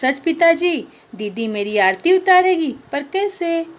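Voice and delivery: put-on boy hearing his sister will come, overjoyed. A narrator's reading. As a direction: jump with joy in the voice. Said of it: "प्रतीक खुश होकर उछल पड़ता है".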